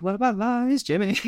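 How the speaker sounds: high-pitched mimicked line; Anna Kendrick. Friend answering talk of lying with a quote, playful impression. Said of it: "I'm hearing Anna Kendrick".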